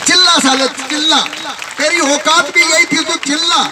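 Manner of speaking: high, scornful jeer; taunting. Acted. Taunting the crying stone. (ऊँची आवाज़ में ताना मारते हुए)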